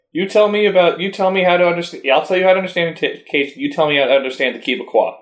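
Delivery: put-on fast, high Cajun accent. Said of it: "my terrible accent".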